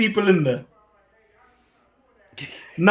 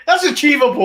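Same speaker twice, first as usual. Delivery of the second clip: higher, loud shout of the same words; 8 semitones higher, 3 dB louder than usual. Acted. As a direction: belt it out as a rally cry. Repeats the claim as a shout.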